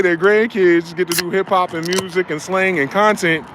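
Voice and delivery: ordinary talk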